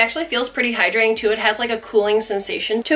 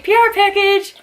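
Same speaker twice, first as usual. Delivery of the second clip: much higher and louder on P R package; excited squeal; 10 semitones higher, 4 dB louder than usual. Real, not acted. Vlogger announcing her first PR package, excited squeal. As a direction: squeal the words out.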